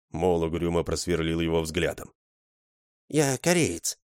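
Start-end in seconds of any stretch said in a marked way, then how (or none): 3.1-4.0 lightly higher, calm Korean's voice; mild correction. Acted. The Korean correcting Mol once more, calmly higher. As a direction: repeat the correction patiently. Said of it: "снова поправил его азиат".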